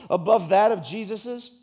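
loud, full-force sermon delivery; preaching. Acted. Pastor preaching hard to the congregation. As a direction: drive it home from the pulpit.

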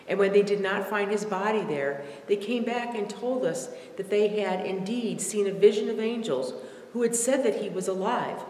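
normal talking voice

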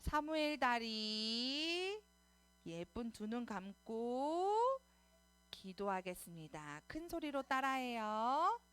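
slow, drawn-out phrase endings. Real, not acted. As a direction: coax little ones into prayer posture, stretching the words. Singsong cues to get ready for prayer.

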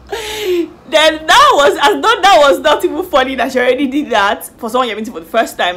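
can barely get the words out for laughing; helpless laughter. Real, not acted. Recounting the dating show scene to viewers, laughing helplessly.

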